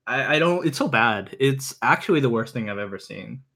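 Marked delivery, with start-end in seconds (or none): none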